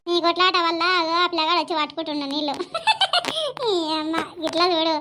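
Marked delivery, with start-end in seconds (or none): 2.6-3.7 high laugh; high falling laugh. Acted. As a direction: laugh at the fight. Laughing at the quarrel.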